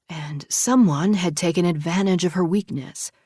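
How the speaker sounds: usual speaking voice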